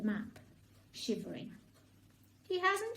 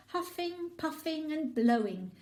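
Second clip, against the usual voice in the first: usual speaking voice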